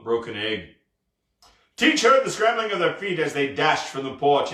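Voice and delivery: ordinary delivery, nothing marked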